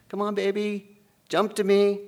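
a dad calling his little one into the water; coaxing father. Acted. Father coaxing child into pool.

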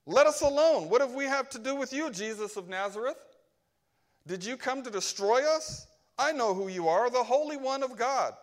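loud, high, frantic demon cry; a possessed man shrieking at Jesus in a synagogue. Acted. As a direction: cry out in alarm at being found. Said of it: "he cried out saying".

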